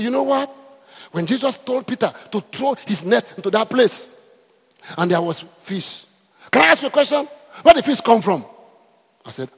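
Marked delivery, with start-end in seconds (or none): 0.0-8.5 high, loud preaching; imitating a shouting preacher; preacher's shout. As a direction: hammer the question like a fiery preacher. Preacher voiced screaming in story.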